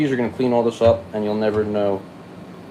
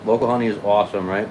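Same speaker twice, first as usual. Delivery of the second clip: normal talking voice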